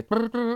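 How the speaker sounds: high mimicked phone ring; phone ring imitation. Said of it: "you'd call and go".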